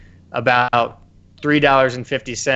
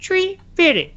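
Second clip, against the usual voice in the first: much higher mock mom voice; Chef's mom. Chef's mom chiming in from the background in a much higher voice. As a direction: squeak the price from the background. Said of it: "Chef's mom in the background is like".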